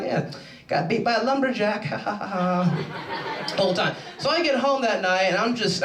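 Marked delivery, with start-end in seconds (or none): 0.0-3.9 lightly voiced taunting girlfriend; mock smirking gloat. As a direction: imitate a smug girlfriend rubbing it in. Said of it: "she was just smirking, smirking, like".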